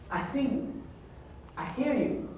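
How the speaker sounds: quiet sincere words; tender. Real, not acted. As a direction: gently reassure the room. Tearful address to audience.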